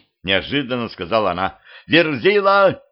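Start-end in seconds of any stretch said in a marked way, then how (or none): 1.9-2.8 high, loud summons; calling out. A narrator's reading. Woman summons her giant helper, calling out. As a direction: shout up to someone far above. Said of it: "крикнула она".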